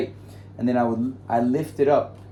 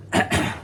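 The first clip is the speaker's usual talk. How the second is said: throat clearing. Real, not acted. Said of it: "I'm losing my voice".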